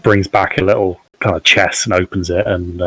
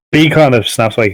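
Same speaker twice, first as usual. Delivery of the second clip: ordinary delivery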